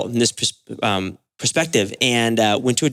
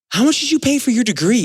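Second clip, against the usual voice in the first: high, scornful challenge; retelling his outraged question to the doctor, high and sharp; indignant retort; 12 semitones higher than usual. Retold or imitated. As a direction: fire back a sarcastic jab. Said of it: "I was so mad".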